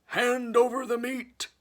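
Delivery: loud, very high, slow bellow; pirate demand. Acted. Braidbeard demands meat at the table, loud roar. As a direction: roar for the meat like a greedy captain. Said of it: "gulped his food and said".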